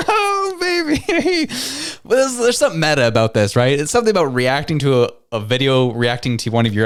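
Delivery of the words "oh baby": high, drawn-out exclamation through laughter; laughing. Real, not acted. Host reacting to a clip, laughing.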